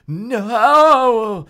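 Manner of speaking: high, loud cry. Acted. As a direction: cry out in terror. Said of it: "Brenda cried again".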